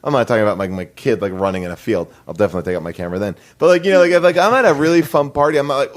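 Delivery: ordinary talk, nothing marked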